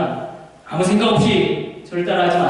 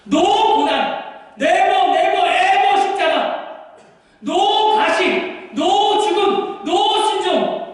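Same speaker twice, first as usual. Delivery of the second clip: pleading cry; 9 semitones higher, much slower than usual. Acted. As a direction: beg him, stretching every no. Peter begging Jesus.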